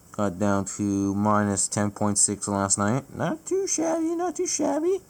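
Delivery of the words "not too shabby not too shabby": high-pitched delight. Real, not acted. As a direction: pipe it up high, playfully pleased. Pleased with the overnight low.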